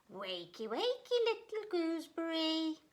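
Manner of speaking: wakey wakey sung up high to wake a chick; a fond sing-song wake-up call; mother goose calling. Acted. Mama Goose waking her little gooseberry, high sing-song call. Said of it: "called Mama Goose".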